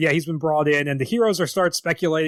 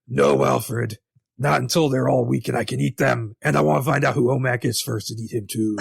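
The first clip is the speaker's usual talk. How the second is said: a hammed-up Batman planning to eat his friends; mock Batman voice; 4 semitones lower than usual. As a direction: play Batman as a joke villain. Batman voiced as joking cannibal.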